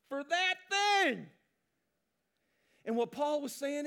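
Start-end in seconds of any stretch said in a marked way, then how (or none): 0.1-1.2 emphatic. Real, not acted. Preacher stressing sacrifice.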